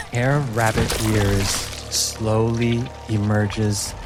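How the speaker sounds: usual speaking voice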